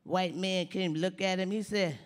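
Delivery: normal talking voice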